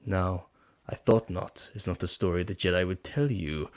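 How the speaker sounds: sly, hushed storyteller menace; Emperor impression. Palpatine tempting Anakin, lightly voiced, low and hushed. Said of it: "that one was fucking good".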